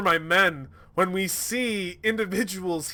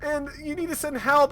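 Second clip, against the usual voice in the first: mock pleading; 10 semitones higher than usual. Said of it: "begged and pleaded and cried".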